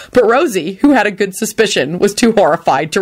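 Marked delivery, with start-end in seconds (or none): none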